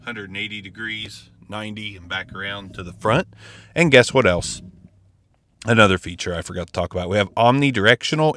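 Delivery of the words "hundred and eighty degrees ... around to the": distant, muffled off-mic voice; off-axis. Real, not acted. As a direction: speak from the side of the mic. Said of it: "talking into the ninety degree angle".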